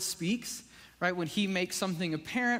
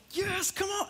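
much higher, bright burst of enthusiasm; eager yes; 13 semitones higher than usual. Retold or imitated. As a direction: pump yourself up. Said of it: "I feel this, like".

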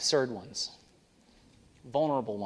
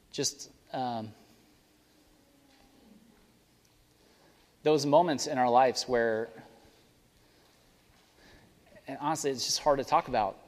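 prayer; 2 semitones lower than usual. Acted. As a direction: pray it gently to close the service. Leads closing prayer.

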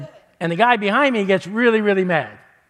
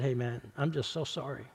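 soft, much quieter, heartfelt sorry; hushed and humble, asking pardon; sincere apology; 4 semitones lower, 11 dB quieter than usual. Retold or imitated. Retold humble apology to driver.

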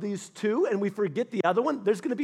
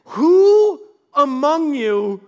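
higher, louder, slow furious demand; angry king; 6 semitones higher, 8 dB louder, slower than usual. Retold or imitated. King Aram demanding a traitor among his men, slow furious shout. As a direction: bellow it like a betrayed king. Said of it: "And he's like".

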